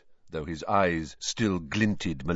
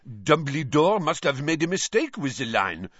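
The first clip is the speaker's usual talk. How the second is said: a shrugging French lady; lightly voiced French shrug; Madame Maxime, shrugging; 7 semitones higher than usual. A narrator's reading. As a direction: careless and dismissive. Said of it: "said Madame Maxime, shrugging".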